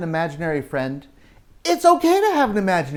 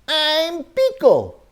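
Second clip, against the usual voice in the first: high, slow little character voice; Beagle's voice; 10 semitones higher, 3 dB louder, slower than usual. Acted. Storyteller voicing Beagle to the kids, high and slow. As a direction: squeak out his name like a shy pup.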